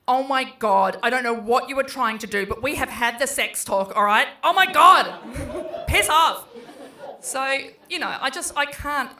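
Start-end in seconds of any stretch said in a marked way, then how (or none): 0.1-6.4 much louder, exasperated and laughing; mortified teen outburst. Retold or imitated. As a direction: blow up at your mum, half-laughing.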